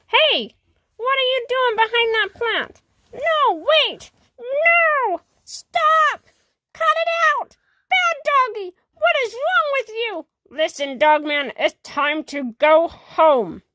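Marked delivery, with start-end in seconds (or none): 0.1-10.2 scolding the dog. Acted.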